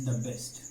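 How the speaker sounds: the said as "da" before best. Shown as a demonstration. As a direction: give the an open "a" vowel, not "ee", before best. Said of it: "यहाँ पर हम the best पढ़ेंगे". (the best में the को दा पढ़ें)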